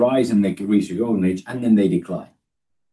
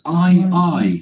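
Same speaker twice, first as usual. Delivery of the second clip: I, I said high and loud, both hit hard; preacher hammers the word I, twice; emphatic doubled I; 9 semitones higher, 5 dB louder than usual. Shown as a demonstration. To the congregation, preacher voicing God with emphatic I. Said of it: "He says twice".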